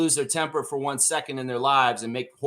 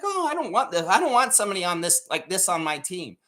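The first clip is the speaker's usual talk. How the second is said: mock fan; 4 semitones higher than usual. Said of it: "You're like".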